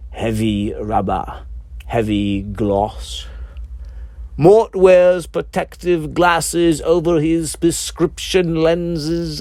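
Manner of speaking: ordinary delivery, nothing marked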